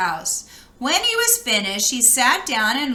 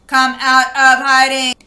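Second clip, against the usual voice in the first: robot call; 8 dB louder than usual. Acted. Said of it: "it boinked".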